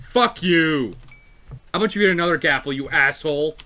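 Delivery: loud, fast furious yelling; angry yelling. Real, not acted. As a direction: blow up at him, fast and loud. Angry outburst at a teammate.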